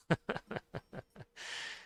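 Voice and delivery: real run of chuckles; chuckling laugh. Real, not acted. Amused at people gatekeeping a Scottish accent, chuckling laugh. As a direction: laugh in a quick, breathy string.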